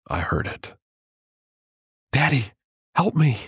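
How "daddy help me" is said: put-on high, pleading little girl voice; strained child's plea. A narrator's reading. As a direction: cry out like a scared child. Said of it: "It was soft, yet insistent, and more than a little strained".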